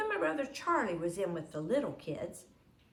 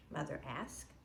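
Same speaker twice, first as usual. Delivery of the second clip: usual speaking voice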